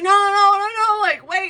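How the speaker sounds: high, loud frantic pleading. Retold or imitated. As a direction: call after her in a panic. He pleads as she leaves.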